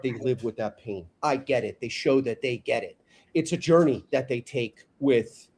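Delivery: usual speaking voice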